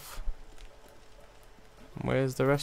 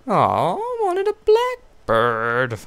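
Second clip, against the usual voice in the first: whine; 5 semitones higher, 5 dB louder than usual. Real, not acted. Missed the killstreak reward.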